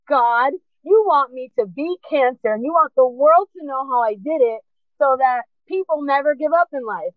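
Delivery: high, fervent relief; lifted pitch, impassioned testimony to God; retold elation. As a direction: exclaim it like a prayer finally answered. Retold elated realisation to God.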